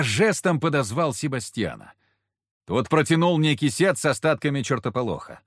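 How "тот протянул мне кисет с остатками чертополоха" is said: ordinary delivery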